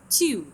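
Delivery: "Two" begun high and loud, gliding down; falling tune. Shown as a demonstration. Teacher demonstrating another word to students, high falling glide. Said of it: "نبدي من نبرة عالية إلى نبرة ناصية".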